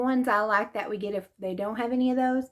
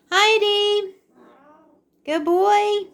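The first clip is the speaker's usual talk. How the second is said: much higher, louder coo; pet talk greeting; 10 semitones higher, 5 dB louder, slower than usual. Real, not acted. Greeting her cat.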